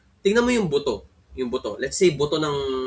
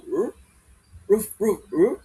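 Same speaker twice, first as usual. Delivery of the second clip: sudden dog bark; 4 semitones higher than usual. Shown as a demonstration. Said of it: "Tas bigla siyang".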